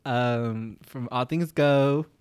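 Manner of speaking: slow host announcing guests; talk show host. Pretend. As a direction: announce the guests like Jimmy Fallon. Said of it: "It's gonna be like".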